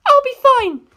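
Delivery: put-on a high, fierce girl set on revenge; Penelope's voice. Penelope bravely swallows fear.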